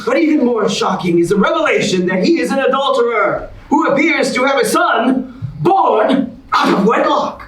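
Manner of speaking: big, bright host announcement; game show host. Acted. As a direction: sell it like a TV host to the audience. Host reveals and eliminates.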